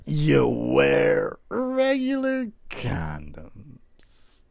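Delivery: very slow, drawn-out insistence; slow emphatic. Real, not acted. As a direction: spell it out slowly and firmly.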